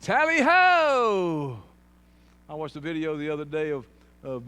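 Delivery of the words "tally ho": loud, high, long drawn-out cry; hammed-up hunting call. Preacher clowning for the congregation, a mock rallying call. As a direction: belt it out and stretch the last word. Joke accent punchline, called out.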